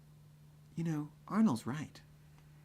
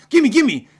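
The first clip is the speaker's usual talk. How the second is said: high, loud, grabbing shout; snatch at it, yelling; frantic guard; 11 semitones higher, 17 dB louder than usual. Retold or imitated. Security guard demanding the ID back, shrill shout.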